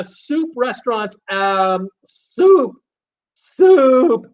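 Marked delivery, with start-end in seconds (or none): 2.0-4.3 playful excited squeal. Pretend. Playful reaction to soup request.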